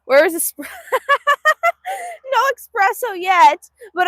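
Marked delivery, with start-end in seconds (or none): 0.7-2.3 high, loud burst of giggles; amused laughter. Real, not acted. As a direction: crack up in a high peal of laughter. Laughs at espresso question.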